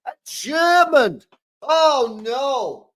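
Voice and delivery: slow, wailing mock lament; mock despair. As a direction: ham up the tragedy of it. Hamming up grief over the German.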